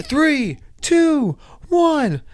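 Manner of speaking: high, loud counting down; countdown call. Retold or imitated. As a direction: call out the count like the jump operator. Jump countdown retold.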